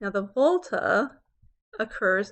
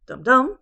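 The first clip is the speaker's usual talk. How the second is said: "dum-dum" loud and higher, both beats strong; double stress; 4 semitones higher, 12 dB louder than usual. Shown as a demonstration. Teacher showing types of feet, two strong beats on "dum-dum". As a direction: hit both halves of "dum-dum". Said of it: "we have different types of feet".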